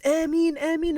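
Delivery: high, loud fervent Ameen; voices filling the mosque. Retold or imitated. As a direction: call it out like a whole praying crowd. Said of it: "our voices filled al-Masjid al-Aqsa".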